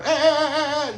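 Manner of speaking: high, sung dolphin squeaks; playful high-pitched dolphin noises; dolphin chirps. Retold or imitated. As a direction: imitate a dolphin clicking and chirping. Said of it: "he speak like a dolphin".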